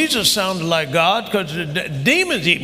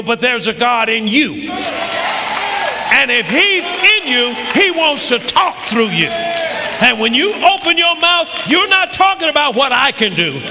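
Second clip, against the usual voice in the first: shouted, much higher and louder preaching; preaching at full force; 9 semitones higher, 5 dB louder than usual. Acted. Pastor urging the congregation to speak like God, shouting and preaching at full force. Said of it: "Boy, I'm preaching".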